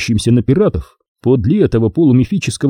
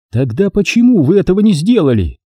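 raised pitch with a slight note of defiance; faint challenge; 8 semitones higher than usual. A narrator's reading. Pirate captain questions the admiral and general, faintly challenging. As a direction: question them with a hint of challenge. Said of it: "появилась слабая нотка вызова".

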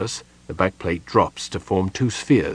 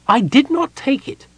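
high, indignant Kender voice, much higher and louder; insulted Kender; 6 dB louder than usual. A narrator's reading. Tass denies taking the artifact, insulted and high. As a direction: bristle at the accusation, voice up high. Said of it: "Tass said, insulted".

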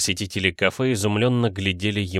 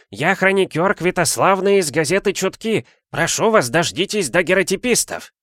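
high-pitched, urgent call from the shore; a reporter hailing people at a distance; 9 semitones higher, 3 dB louder than usual. A narrator's reading. (высокий призывный крик репортёра)

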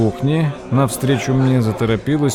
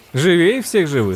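laughing; 5 semitones higher than usual. Acted. Remark with a laugh.

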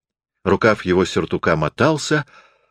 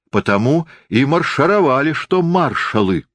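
plain reading voice

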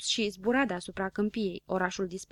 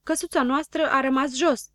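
raised, louder exclamation; excited shouting; 5 semitones higher, 5 dB louder than usual. A narrator's reading. Habar n-am spots the house below, calling out. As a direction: cry out the discovery. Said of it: "începu să strige Habar n-am".

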